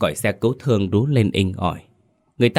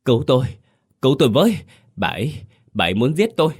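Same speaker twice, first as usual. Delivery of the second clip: patient howling to the nurses in terror; terrified howl; 6 semitones higher, much faster than usual. A narrator's reading. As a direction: howl that she wants to kill him. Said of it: "Hắn gào lên".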